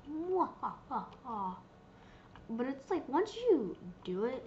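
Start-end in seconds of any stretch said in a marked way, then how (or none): 0.1-1.6 slow, hammed-up villain cackle; spelled-out slow mwahaha; mock evil laugh. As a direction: gloat like a cartoon villain. Boasting awesome power.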